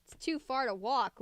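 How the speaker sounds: ordinary delivery, nothing marked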